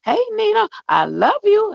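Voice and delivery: high, playful voice of a friendly coworker greeting her; voicing a grinning regular who greets her every day; imitating the cheerful man. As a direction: pitch way up for his happy hello. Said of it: "and be like".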